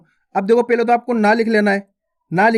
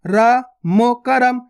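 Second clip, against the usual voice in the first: spelled out slowly; 2 semitones higher, much slower than usual. Shown as a demonstration. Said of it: "इस प्रकार से सुन रहे हो करम".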